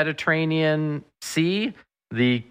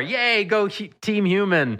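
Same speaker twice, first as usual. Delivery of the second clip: higher-pitched playful rooting cheer; mock team cheer; 9 semitones higher than usual. Guest rooting for the human species, mock cheer. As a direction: cheer on your team, tongue in cheek.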